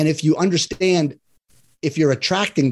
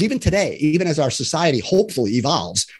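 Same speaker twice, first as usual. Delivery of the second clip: ordinary talk, nothing marked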